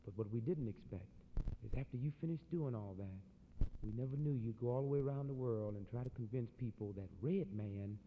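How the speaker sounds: low, soft grave accusation; quiet, measured accusation; solemn low indictment. Retold or imitated. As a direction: drop it low and heavy. Red man's grave indictment.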